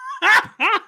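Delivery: high burst of real laughter. Real, not acted. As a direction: crack up, unable to keep a straight face. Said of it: "hard for me to even say this with a straight face".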